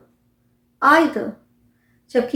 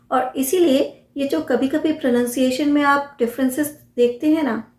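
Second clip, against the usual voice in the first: ordinary talk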